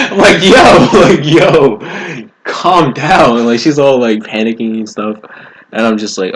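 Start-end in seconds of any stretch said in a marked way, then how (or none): 0.4-3.4 high, laughing plea; calm her down through laughter. Retold or imitated. Son tells panicking mom to calm down, laughing.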